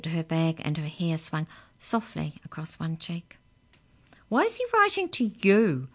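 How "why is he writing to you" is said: put-on high, louder girl's demand; Amy snaps the question, voiced higher. Amy demands why he writes to Peter, higher and louder. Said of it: "demanded Amy".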